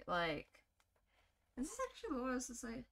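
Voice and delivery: ordinary talk, nothing marked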